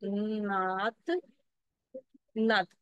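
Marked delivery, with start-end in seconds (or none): 0.0-1.1 नात with the aa drawn out very slowly; long vowel demo. Shown as a demonstration. Teacher showing the long aa to pupils. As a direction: stretch नात, slow and long. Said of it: "यहाँ पर difference है ना".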